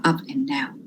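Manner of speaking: soft unvoiced whisper; whispered echo. Shown as a demonstration. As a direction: repeat "up and down." in a whisper. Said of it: "it's always up and down".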